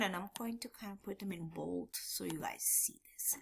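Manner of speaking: unvoiced muttered aside; soft whispered aside. Real, not acted. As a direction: whisper to yourself while typing. Muttered aside while typing.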